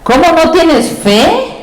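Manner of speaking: slow, high, questioning rebuke; Jesus rebuking. Acted. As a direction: draw the question out in reproach. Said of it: "te está diciendo Jesús". (Jesús reprochando, lento y agudo)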